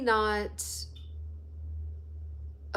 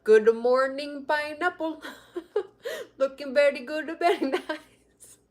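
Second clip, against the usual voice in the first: mock Indian accent; 5 semitones higher than usual. Viewer imitating an Indian man's morning greeting video, sing-song mock Indian accent. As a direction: croon it like a cheery Indian uncle greeting you. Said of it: "he's like".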